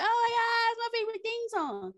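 high-pitched gushing squeal; excited squeal. Retold or imitated. Recalling her favorite theme song, high excited squeal. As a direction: gush like a thrilled fan. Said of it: "I was like".

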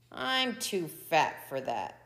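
ordinary delivery, nothing marked

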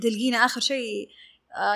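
ordinary delivery

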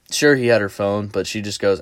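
normal talking voice

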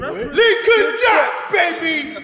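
screaming wrestling entrance; hype shout. Pretend. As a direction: belt it like a ring entrance. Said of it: "that was a good intro".

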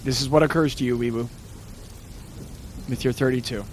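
low, hushed dread; grave, low voice with heavy pauses; ominous game master narration. Acted. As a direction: let the danger sink in, low and soft. Game master voices dawning realization.

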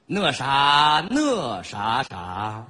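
slow, drawn-out poetic recital; recitation tone. Pretend. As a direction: recite like a grand poem, stretching each line. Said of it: "主要听这个朗诵腔".

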